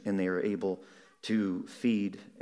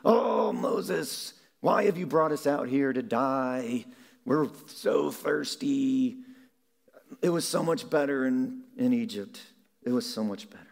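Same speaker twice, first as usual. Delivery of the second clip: grumbling Israelites; 4 semitones higher, 4 dB louder than usual. Acted. The people complaining again to Moses, in a higher whiny moan. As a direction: moan to Moses that Egypt was better. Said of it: "they complain and they grumble again, saying".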